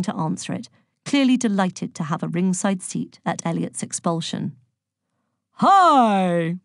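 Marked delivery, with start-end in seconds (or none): 5.6-6.6 big, loud American greeting; drawn-out, booming American hello; booming American accent. A narrator's reading. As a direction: boom it out like a hearty American. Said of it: "boomed a vast man in an American accent".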